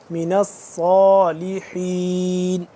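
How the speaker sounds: الصالحین with a thick, heavy ص, not a thin س; heavy saad. Shown as a demonstration. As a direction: darken the ص in الصالحین. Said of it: "سین اور صاد میں فرق کرنا ہے".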